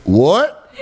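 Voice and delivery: high, incredulous mock-Southern question; Southern accent. Boss baffled by Steinbeck, high mock Southern accent. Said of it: "they have, like, Southern accent".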